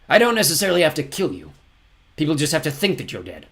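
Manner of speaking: considering villain captain. Acted. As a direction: offer terms to your victim.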